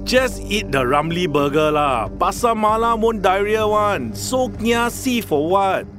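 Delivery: coaxing Singlish persuasion; singaporean accent. Shown as a demonstration. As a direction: urge a friend in Singlish. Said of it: "kiasi might be used like so".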